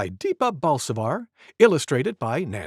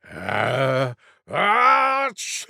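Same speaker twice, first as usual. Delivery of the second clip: big sneeze; much slower than usual. Acted. Wild Boar tries a deep-breath sneeze. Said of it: "taking a deep breath".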